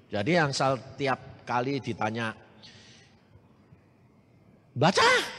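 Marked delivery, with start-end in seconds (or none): 4.7-5.3 sharp high questioning teacher; teacher's question. Retold or imitated. Teacher in class demanding the pupil read, sharp and high.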